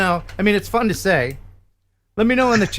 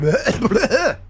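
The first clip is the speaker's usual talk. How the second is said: mock gagging; 6 semitones higher than usual. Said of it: "And I'm like".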